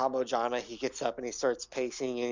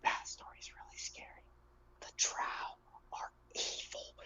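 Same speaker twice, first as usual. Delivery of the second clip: fearful whisper; 12 dB quieter than usual. Acted. Gnome warning adventurers about the drow, fearful whisper. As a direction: whisper it like a dreadful secret.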